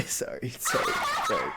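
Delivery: giggling through sorry, sorry; laughing apology. Real, not acted. Reviewer laughing at his own rambling, giggly apology.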